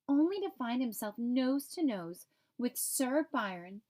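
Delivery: ordinary delivery, nothing marked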